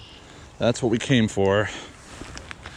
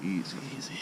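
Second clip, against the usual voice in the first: soft calming murmur; soothing; 2 semitones lower, 13 dB quieter, faster than usual. Real, not acted. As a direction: gently settle the fish. Calming the fish.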